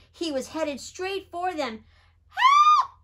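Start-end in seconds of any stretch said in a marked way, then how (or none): 2.3-2.9 very high, loud panicked scream; cry for help. A narrator's reading. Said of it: "cried Big Jack".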